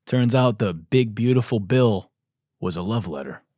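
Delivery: usual speaking voice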